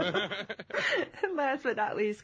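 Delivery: slow, drawn out through laughter; laughing introduction. Real, not acted. Host introducing last guest, through laughter. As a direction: carry on introducing while still laughing.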